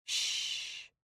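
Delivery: soft unvoiced shush; hush. A narrator's reading. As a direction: hush her gently. Comforting crying friend.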